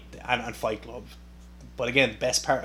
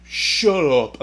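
louder, slow mock insult; half-serious heckle; imagining trashing the star online, louder; mock trash talk; 7 dB louder, much slower than usual. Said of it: "imagine me going in, going".

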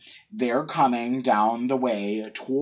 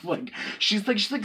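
normal talking voice

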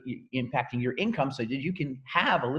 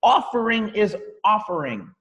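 "Offering is offering" slow, higher and louder; stress on offering; 6 semitones higher, 4 dB louder than usual. Shown as a demonstration. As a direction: hammer "Offering" twice, slowly. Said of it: "I'm emphasizing that word specifically".

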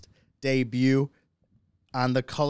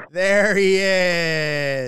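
loud, high, long-drawn cheer; excited greeting; 6 semitones higher, 9 dB louder, much slower than usual. Real, not acted. Host welcoming his friend on the show, hollering. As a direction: holler it out, stretching the words.

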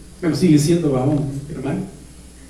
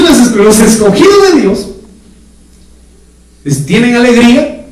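ordinary talk, nothing marked

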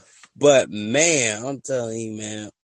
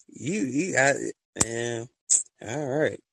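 usual speaking voice

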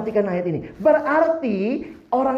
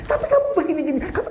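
mock scolding mother, pitched up; a hint of a nagging mom repeating herself; 8 semitones higher, faster than usual. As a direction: play a mother who won't stop scolding. Mother nagging child, retold.

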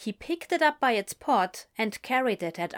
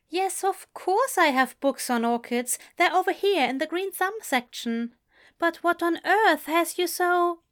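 Aziraphale voice; 9 semitones higher, 3 dB louder than usual. Acted.

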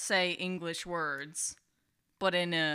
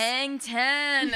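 high, stretched surfer-dude Californian; mock Californian accent; 6 semitones higher, 3 dB louder than usual. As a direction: put on an exaggerated California accent. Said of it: "sound like someone from California".

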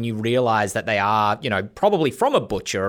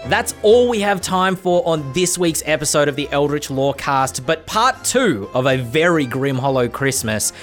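podcast outro; 6 semitones higher, 3 dB louder than usual. Acted. Podcast outro over music.

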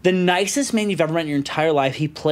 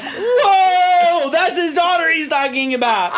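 high, drawn-out mock disbelief; shocked exclamation; 11 semitones higher, slower than usual. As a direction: react aghast, pitch shooting up. Teller's shocked reaction.

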